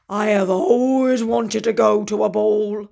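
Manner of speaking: longing, storybook wolf; dreamy wolf. Acted. The wolf confides in Gertie, wistful.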